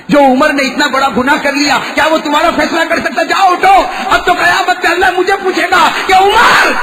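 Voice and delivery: high, loud remorseful cry; preaching at full force. Acted. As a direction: cry out the caliph's guilt. Preacher voicing Umar's remorse.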